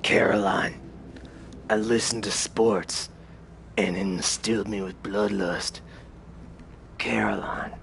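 low, raspy narrator voice; raspy narration. Acted. Player narrating to Caroline about bloodlust, raspy voice. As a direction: rasp it out like a brooding narrator. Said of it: "if I don't have my raspy voice".

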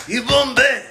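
high, loud hammed-up Chinese-African accent; mixed Chinese accent. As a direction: ham up a clashing mashup of accents.

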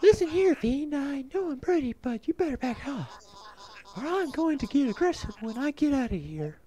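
high-pitched, puny voice of bravado; blustering game character. Acted. Captive warning a fiend to back off, high blustering voice.

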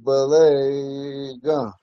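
Belega stretched out very slowly. Shown as a demonstration. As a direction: drag out every sound of Belega. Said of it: "Let me do it like this".

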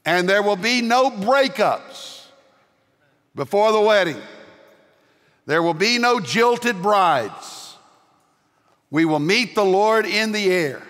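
full-force preaching. Acted. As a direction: lift the congregation with rising fervour.

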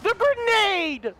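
high, slow desperate plea; panicked pleading. Real, not acted. Gamer pleading with the angry creature after a grenade, high and desperate. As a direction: beg the monster not to be mad.

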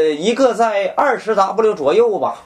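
normal talking voice

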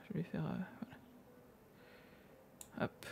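whispered aside to herself. Real, not acted. Streamer mid-drawing, whispering to herself. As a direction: breathe the words under her breath.

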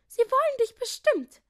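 very high, loud twittering voice; chirping. A narrator's reading. Rosalinda encouraging the new king, high delighted chirp. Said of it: "zwitscherte Rosalinda".

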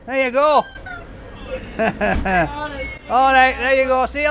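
high, loud cheery hello to strangers; excited greeting. Real, not acted. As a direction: hail them brightly.

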